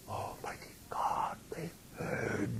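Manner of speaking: breathless, terrified whisper; horrified whisper. Acted. Narrator realising the officers heard, terrified whisper. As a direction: gasp it out in dread.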